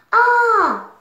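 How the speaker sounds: loud high gasp of alarm; alarmed cry. Acted. Storyteller crying out as the islands go dark, alarmed. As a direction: cry out as all goes dark.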